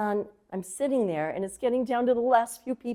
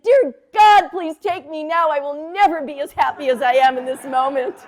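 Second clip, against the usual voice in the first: much louder and higher, bursting with joy; ecstatic prayer; 4 semitones higher, 10 dB louder than usual. Retold or imitated. Leaving the studio she calls to God, loud and elated. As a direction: cry it to the sky overjoyed.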